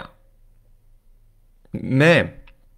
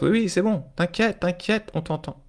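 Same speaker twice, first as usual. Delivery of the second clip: reassuring; 6 semitones higher than usual. Shown as a demonstration. Reassuring one's own stress.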